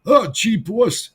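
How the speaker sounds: loud, high, dopey lunkhead voice; big dumb cop. Retold or imitated. Dumb cop answering his boss, in a loud goofy voice. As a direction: play a dim sidekick calling out to his boss. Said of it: "plays a big dumb cop".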